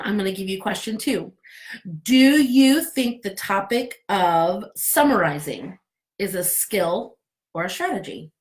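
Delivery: ordinary delivery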